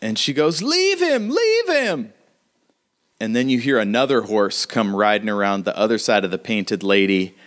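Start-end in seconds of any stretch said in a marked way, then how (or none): 0.6-2.1 high falsetto insistence; urgent plea. Acted. Brunette pulls the girl from the body, high urgent falsetto. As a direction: pull her away in a high voice.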